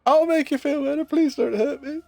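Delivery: mock-frightened pet voice; high timid dog voice. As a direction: beg like a scared little dog. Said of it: "This is".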